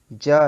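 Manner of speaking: ज said alone, loud, clean, with no trailing ha; unaspirated consonant demo. Shown as a demonstration. Teacher contrasting plain ज for students, loud and clean. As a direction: cut ज off sharp with no breath after it. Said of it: "इनमें हकार नहीं".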